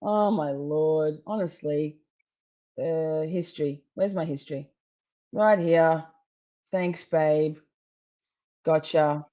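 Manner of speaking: normal talking voice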